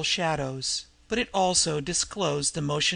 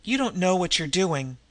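usual speaking voice

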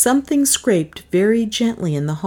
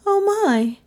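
high-pitched woman's character voice; much higher than the narration; Melissa's voice. A narrator's reading. Melissa reacting to the news, high character voice.

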